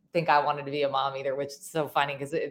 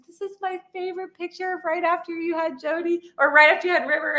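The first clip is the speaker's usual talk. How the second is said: much higher imitated voice; imitated mom; 11 semitones higher than usual. Mom showing daughter favorite picture, high imitated voice. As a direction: pitch it way up as Mom. Mother's remark acted in story.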